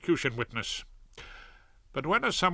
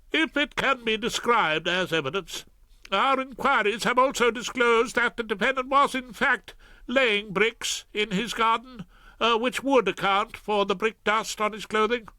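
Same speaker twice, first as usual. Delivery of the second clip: high, anxious prosecutor; apologetic Ballard; 9 semitones higher, 3 dB louder than usual. Acted. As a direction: admit defeat uneasily. Ballard voiced.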